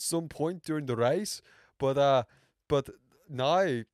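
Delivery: put-on Irish brogue for race chat; hammed-up Irish accent. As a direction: keep up the playful Irish accent. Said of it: "in quite an Irish accent".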